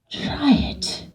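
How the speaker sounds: soft, breath-only dare; hushed go-ahead. Retold or imitated. Director letting the actor try her idea, hushed. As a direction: drop to a near whisper to permit it.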